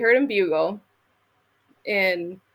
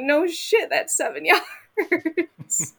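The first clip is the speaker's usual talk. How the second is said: higher, slowing down with a laugh; laughing retort; 8 semitones higher, slower than usual. Retold or imitated. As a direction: laugh it off at husband. Retorting to husband while laughing.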